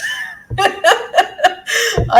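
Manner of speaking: high burst of real laughter. Real, not acted. Laughing at having to fast right after converting, high real laugh. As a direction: laugh out loud at the irony. Punchline about Ramadan starting tomorrow.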